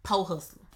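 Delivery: "po hustler" with the r dropped, said "poh"; imitating granddaddy's "po hustler"; grandfather's "po". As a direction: say "po" like "poh", no r sound. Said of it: "but he say po".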